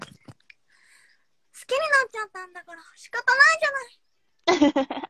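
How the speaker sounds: high, loud anime heroine line; anime girl voice. Pretend. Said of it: "やって".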